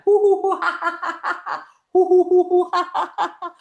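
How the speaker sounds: loud high hoo-hoos breaking into laughter; playful whoop-and-laugh in repeated bursts; cooling-down hoots. Pretend. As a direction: hoot as if fanning a scalded mouth, then laugh. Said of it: "fan your mouth to cool it down".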